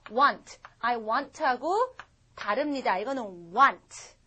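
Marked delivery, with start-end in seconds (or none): 0.1-0.6 "want" with one simple vowel; single-vowel want. Shown as a demonstration. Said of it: "한 번 나고 끝이에요". 3.5-4.1 "want" with one simple vowel; single-vowel want. Shown as a demonstration. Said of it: "한 번 나고 끝이에요".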